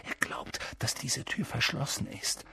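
hushed, breath-only voice; whispering. A narrator's reading. Harry reassuring friends behind the door, whispering. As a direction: whisper so nobody outside hears. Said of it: "flüsterte Harry".

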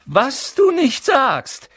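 high, loud, drawn-out sneer; mock him with stretched words; höhnisch. A narrator's reading. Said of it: "versuchte ein höhnisches Grinsen". (höhnisch, hoch und gedehnt)

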